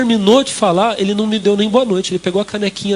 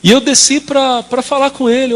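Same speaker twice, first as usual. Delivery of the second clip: ordinary talk, nothing marked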